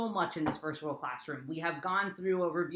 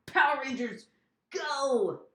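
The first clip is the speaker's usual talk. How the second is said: high, called-out superhero rally; Power Rangers battle cry; 6 semitones higher than usual. Pretend. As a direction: launch the team with a playful battle cry.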